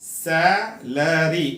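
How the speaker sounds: "सैलरी" drawn out slowly in parts; syllable by syllable. Shown as a demonstration. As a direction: sound out सै-ल-री for memorising. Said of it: "याद करने का तरीका क्या है". ("सैलरी" धीरे-धीरे, हिस्सों में तोड़कर)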